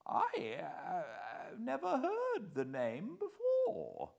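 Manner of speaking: put-on slow, high, bewildered old voice; as if the floor dropped away; dazed. A narrator's reading. Old man denies knowing Scudder, slow and dazed. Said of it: "in a dazed voice".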